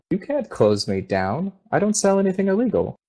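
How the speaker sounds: a protesting shopkeeper whose voice jumps up and speeds up at the end; an alarmed, defensive merchant pushing back; indignant shopkeeper. Acted. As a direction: insist you've done nothing wrong, rising fast and high. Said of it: "you can hear Theb responding".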